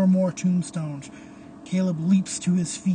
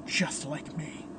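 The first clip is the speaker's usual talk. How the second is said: talking to oneself; 2 semitones lower, 8 dB quieter than usual. A narrator's reading. Phantom paces alone in the bell tower, murmuring to himself. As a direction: think aloud under your breath. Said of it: "hears the Phantom speaking".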